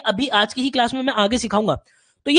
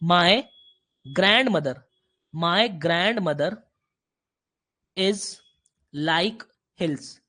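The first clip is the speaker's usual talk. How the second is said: slow halting example; 5 semitones lower, 3 dB quieter, much slower than usual. Shown as a demonstration. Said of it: "मैं ये कह दूं".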